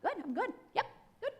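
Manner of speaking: loud, high, over-bright laughing denial; fake fine. Survivor hiding pain behind an outside, hammed-up fake cheerfulness. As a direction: insist too hard, laughing it off. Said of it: "My outside, straight A student".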